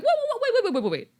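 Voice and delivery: high, rapid-fire stammer of disbelief; comic stunned interruption; mock shock. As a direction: halt everyone in startled high pitch. [name] halts relatives in shock.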